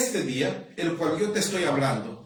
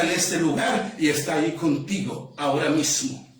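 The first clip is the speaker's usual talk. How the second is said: low, measured voice with long pauses; hushed, solemn preaching; 3 semitones lower than usual. Real, not acted. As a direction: bring it right down and speak intimately to each listener. Hushed, slow preaching after climax.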